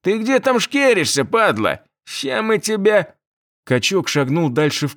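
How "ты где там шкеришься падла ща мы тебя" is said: russian street thug. A narrator's reading. Thug threatens hidden man.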